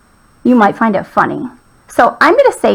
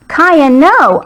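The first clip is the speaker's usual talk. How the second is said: higher-pitched scolding call, not truly shouted; mild yell; 5 semitones higher, 3 dB louder than usual. Acted. Owner to dog jumping at a frog, mild yell. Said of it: "I yelled".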